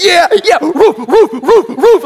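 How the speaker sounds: high, loud cheering that turns into barks; hyping up. Retold or imitated. Owner barking along with his dog, hyped up. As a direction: whoop and woof along with the dog. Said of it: "I'm gonna hype my man up".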